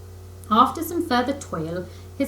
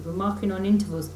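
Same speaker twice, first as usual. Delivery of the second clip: ordinary delivery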